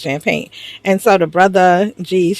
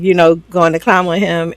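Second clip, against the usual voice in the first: usual speaking voice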